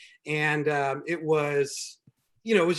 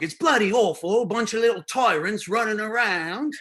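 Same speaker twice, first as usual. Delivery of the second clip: mock British accent; 6 semitones higher, 4 dB louder than usual. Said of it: "she's like".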